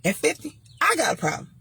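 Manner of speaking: ordinary delivery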